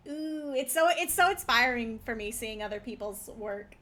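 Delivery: ordinary talk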